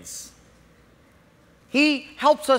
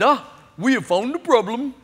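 put-on Indian accent; 3 semitones higher than usual. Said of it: "East Indian accent".

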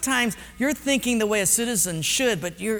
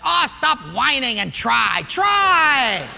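loud, rising to a high shout; scold, then bellow the last word; impatient scolding; 4 semitones higher, 7 dB louder, much slower than usual. Acted. Personnel guy scolds the contestant, loud and impatient.

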